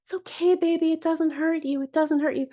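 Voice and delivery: high, cooing reassurance to a screaming dog; mock-sweet soothing of a panicked dog; soothing baby talk. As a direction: coo it high like comforting a baby. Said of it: "holding the foot going".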